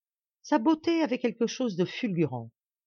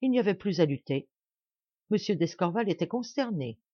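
plain reading voice

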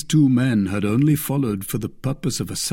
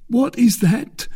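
high, slow, anxious question; uneasy; 12 semitones higher, 4 dB louder, slower than usual. A narrator's reading. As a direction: ask warily in a high captive's voice. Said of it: "uneasy at perceiving that all was not over".

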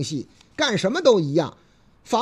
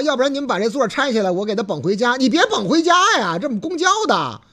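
ordinary delivery

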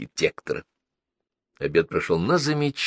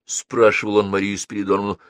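plain reading voice

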